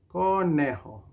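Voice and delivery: "Conejo" stretched out, first syllable held; say-along model. Shown as a demonstration. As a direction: draw out "Conejo" so everyone joins in. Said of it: "Say it together now".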